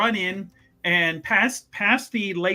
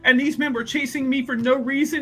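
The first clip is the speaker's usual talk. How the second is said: grovel like a helpless innocent, pitched way up; pathetic pleading; 6 semitones higher than usual. Acted. Thief begs Mother Superior to believe his innocence, high and pitiful. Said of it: "he's gonna make himself look as pathetic as possible".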